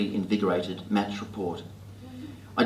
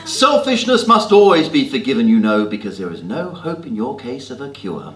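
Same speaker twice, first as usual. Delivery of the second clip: Jane Austen English; 3 dB louder than usual. Pretend. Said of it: "Or to a mugger".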